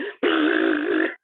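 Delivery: sharp startled gasp; imitated gasp. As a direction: gasp as if the first pop just went off. Imitating popcorn's first pop.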